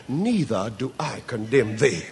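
low, soft words of mercy; gentle pardon. A narrator's reading. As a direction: speak it as quiet forgiveness. Jesus's gentle pardon, then repeated loud.

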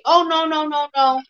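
neighbor scolding. Retold or imitated. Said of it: "She'll say".

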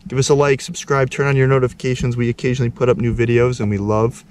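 loud upbeat outro read; YouTube outro. Acted. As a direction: pitch the channel with energy. Outro call to subscribe.

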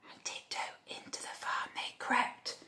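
hushed storyteller's whisper; very soft, secretive whisper; tiptoe whisper. A narrator's reading. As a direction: whisper it as if creeping in. Narrator acts out tiptoeing in.

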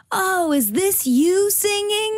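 high, slow mocking voice of a clubgoer; hammed-up dude asking a dumb question; mock guy. Singer mimics a guy in a club hitting on her, high and slow. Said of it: "and he was like".

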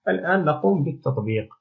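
normal talking voice

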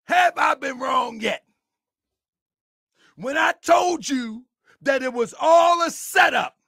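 loud, high-pitched vindication; yelling. Real, not acted. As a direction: yell it like a fired-up preacher. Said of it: "am I yelling too much?".

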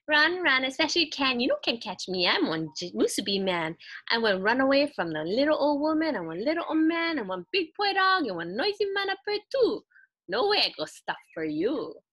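high, loud sing-song taunt in pidgin; a gleeful little runaway rice ball; cheeky Musubi man. Acted. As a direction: tease them from far ahead, chanting. Musubi man taunts his chasers.

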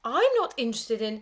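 loud dismissive snap breaking off; teacher refusing the nibbled doughnut; irritated teacher. A narrator's reading. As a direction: brush the pupil off crossly. Teacher cut off by shock.

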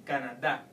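"Canada" with a loud, punched final DA; stress on last syllable. Shown as a demonstration. Teacher demonstrating the tilde, final-syllable stress. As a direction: hit the end of "Canada" hard. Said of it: "stress the word in that syllable".

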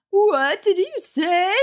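can't believe what she heard; outraged mother. A narrator's reading. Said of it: "voice tight with growing outrage".

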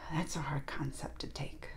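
soft whisper to yourself; hushed whispered musing; whispered aside. Real, not acted. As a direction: breathe it almost to yourself.

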